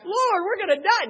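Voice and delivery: high, loud, terrified wail; a loud high mock terror; panicked cry. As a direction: cry out that death is near. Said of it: "That's what we're saying".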